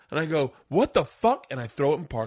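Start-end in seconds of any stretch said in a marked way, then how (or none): 0.7-1.5 high indignant outburst; mock fury, pitch shooting up; retold outrage. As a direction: act out being freshly insulted. Retold angry reaction to insult.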